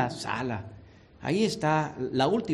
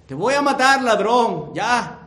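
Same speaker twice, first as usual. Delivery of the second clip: soldier's threat; 5 semitones higher, 8 dB louder than usual. Acted. Roman soldier threatens thief.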